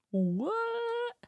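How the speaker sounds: high, jumping what; mock surprised what. Real, not acted. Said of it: "mock defensive what".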